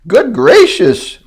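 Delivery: high, slow, loud gasp of surprise. Acted. Maisie seeing Horton in the tent, high gasping surprise. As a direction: gasp it out, startled. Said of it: "gasped Maisie".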